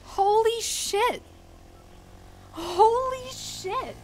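high, slow, drawn-out; stunned shock. Real, not acted. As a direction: stretch it out, still reeling. Recovering from jump scare.

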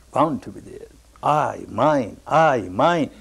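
ordinary delivery

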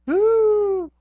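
very high, loud excited whoop. Real, not acted. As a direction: let out a joyful yell.

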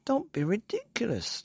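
high, lightly scornful bird voice; a touch of a disbelieving bird; roly-poly bird. A narrator's reading. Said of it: "the roly-poly bird said".

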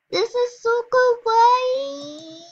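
very high, slow squeal; excited anime fan. Real, not acted. Squealing over cute outfit.

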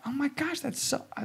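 high, thrilled gasp of delight; excited gushing. Retold or imitated. Imitating his partner praising him, high excited gush. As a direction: squeal it like you're overjoyed for him.

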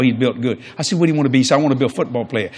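ordinary talk, nothing marked

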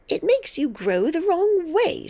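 high, loud, cross grandmother; irritable, emphatic correction; snapped. A narrator's reading. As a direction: snap it crossly at the child. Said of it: "she snapped".

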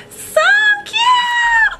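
a gasp, then a very high squeal; excited gasp. Real, not acted. Squealing at her viewers over the cute covers.

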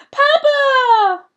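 high, very loud cry of alarm; terrified scream. A narrator's reading. Daughter screaming as her father is knocked down. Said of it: "Marguerite screamed".

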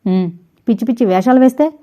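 quick mocking echo rising at వేస్తే; taunting repeat ending in a rise on వేస్తే; teasing challenge. A narrator's reading. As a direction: throw her words back at her, daring. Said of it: "రెట్టించి ఆమె కళ్ళలోకి సూటిగా చూస్తూ అడిగాడు".

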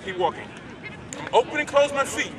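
normal talking voice

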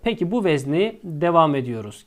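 usual speaking voice

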